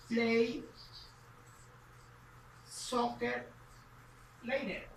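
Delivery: word by word with long pauses; slow, spaced-out model question; slow dictation. Shown as a demonstration. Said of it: "entonces diríamos".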